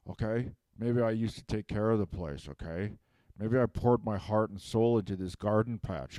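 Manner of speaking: usual speaking voice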